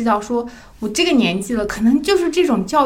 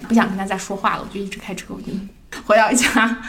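normal talking voice

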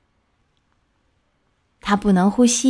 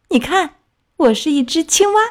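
higher-pitched, self-satisfied announcement; proud, boastful; 7 semitones higher than usual. A narrator's reading. Tadpole telling the minnow it is a frog, proudly. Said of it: "它得意地说".

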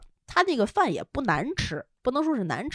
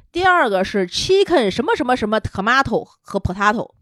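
ordinary talk, nothing marked

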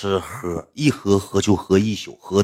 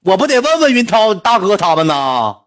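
mock husband's reply; 11 semitones higher, 5 dB louder than usual. Voicing husband's reply in story.

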